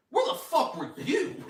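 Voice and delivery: high, loud mock outrage; indignant retort. Knight firing back at his accuser, indignant. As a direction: throw the accusation back, half laughing.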